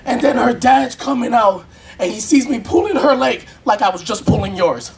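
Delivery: usual speaking voice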